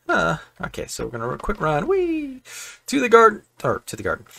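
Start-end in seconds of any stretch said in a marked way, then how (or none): 1.9-2.4 high, loud "whee!"; playful whee. Pretend. Playful dash off for materials. 2.9-3.4 high, loud call to action; mock-heroic shout; playful rallying cry. Mock-heroic call while dashing off.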